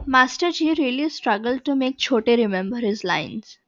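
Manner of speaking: usual speaking voice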